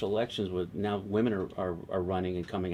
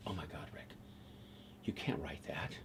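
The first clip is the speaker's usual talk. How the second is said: whisper; 6 semitones lower, 15 dB quieter than usual. Retold or imitated. Writer warns him privately.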